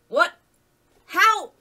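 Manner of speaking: high, bewildered questions; startled. A narrator's reading. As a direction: blurt each word in disbelief. Alex startled by sudden shift.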